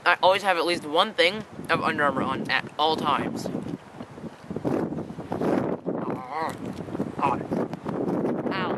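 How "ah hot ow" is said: sharp ow at hot food; hot food gasp. Real, not acted. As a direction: react to a burning mouthful.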